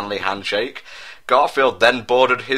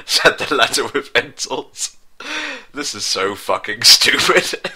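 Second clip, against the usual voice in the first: ordinary delivery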